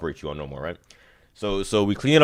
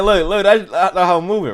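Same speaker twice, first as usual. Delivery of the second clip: excited show; 9 semitones higher, 4 dB louder than usual. Real, not acted. Showing the thumb moving.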